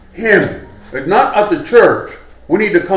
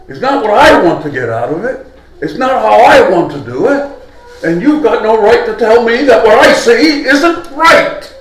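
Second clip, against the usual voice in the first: louder, higher, insistent protest; defiant objection; 6 semitones higher, 4 dB louder than usual. Acted. Self-willed worshipper rejects being told he sees it wrong, defiant. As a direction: build into indignant self-defence.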